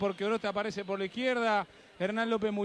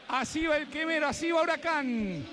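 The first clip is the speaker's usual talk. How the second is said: announcer presentation; 5 semitones higher than usual. Acted. (anuncio de presentación del equipo, voz de locutor)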